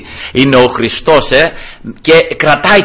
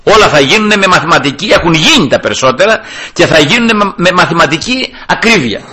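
ordinary talk, nothing marked

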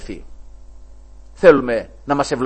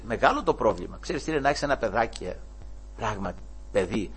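ordinary delivery

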